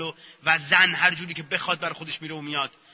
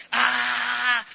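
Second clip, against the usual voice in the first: high demonstrative yell; venting scream; 5 semitones higher, much slower than usual. Acted. Acting a client told to shout, venting scream. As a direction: cry out high, as if letting anger out. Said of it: "هر چی هم خواستی داد بزن".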